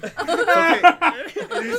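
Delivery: high, slow, laughing "it's okay"; laughing dad voice. Retold or imitated. Son retelling dad's calm line, laughing through it. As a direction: stretch it out while cracking up.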